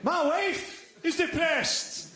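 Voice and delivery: high, slow, wounded heckler voice; hammed-up aggrieved heckler; mock heckler. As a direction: whine it like an offended heckler. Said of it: "He went".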